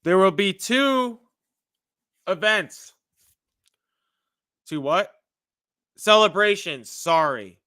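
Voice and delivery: ordinary talk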